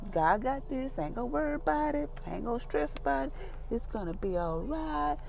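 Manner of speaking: high, hushed acted self-talk; soft, high-pitched, carefree reassurance; unworried self-reassurance. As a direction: softly voice someone calmly talking themselves down. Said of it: "you're not stressing and worry about it".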